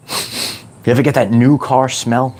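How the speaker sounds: ordinary talk, nothing marked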